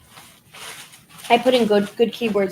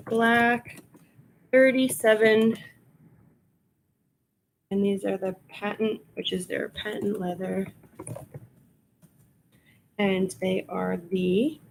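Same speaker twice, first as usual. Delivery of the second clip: ordinary delivery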